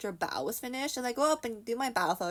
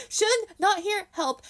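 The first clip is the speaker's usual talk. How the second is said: high, loud mock cry for help; comic distressed appeal; mock plea; 12 semitones higher, 7 dB louder than usual. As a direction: plead in broken phrases, hammed-up. Mimics own broken plea for help.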